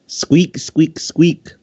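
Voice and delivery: squeaky high sound-effect voice, squeak squeak squeak; a playful squeak read from the fic. A narrator's reading. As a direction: chirp it like a little noisy machine.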